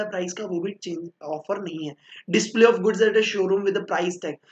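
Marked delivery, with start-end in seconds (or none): 2.3-4.4 slow dictation. Shown as a demonstration. Dictating the definition from the book.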